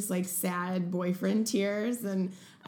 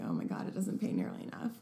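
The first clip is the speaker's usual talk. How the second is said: whispered complaint; 6 semitones lower, 6 dB quieter, faster than usual. Retold or imitated. Quoting own complaint about pay.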